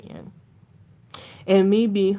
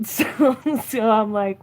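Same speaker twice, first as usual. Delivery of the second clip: usual speaking voice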